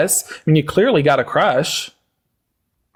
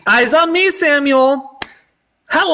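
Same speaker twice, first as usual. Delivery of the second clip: high sing-song classroom call; kindergarten teacher voice; 7 semitones higher, slower than usual. Acted. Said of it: "One, two, three".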